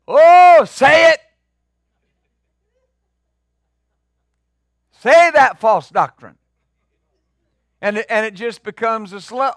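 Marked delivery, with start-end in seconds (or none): 0.1-6.4 much higher, loud cry of approval; shouted call-out. Retold or imitated. Preacher mimicking a pew member shouting approval, loud and high. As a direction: shout encouragement from the pews. Congregation egging on the testifier.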